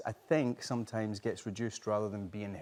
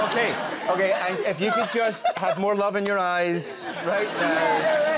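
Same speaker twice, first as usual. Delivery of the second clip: louder, higher, laughing through it; laughing, exasperated director; 8 semitones higher, 7 dB louder than usual. Retold or imitated. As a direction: brush it off with a laugh. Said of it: "And you're like".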